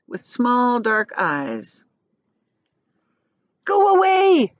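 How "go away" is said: high loud shout. Acted. Friends shouting at the shark, high and loud. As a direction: yell it at the shark. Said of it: "they shouted".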